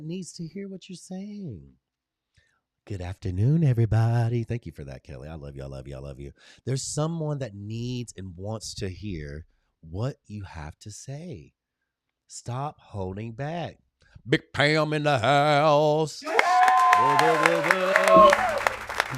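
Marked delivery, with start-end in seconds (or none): 4.4-6.3 tender affection. Real, not acted. Tender thanks to viewer. 14.3-19.2 loud, slow, drawn-out whoa; host cheering as a viewer joins, loud whoas; hype shout. Real, not acted. Host hypes arrival of Big Pam.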